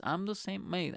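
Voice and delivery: ordinary delivery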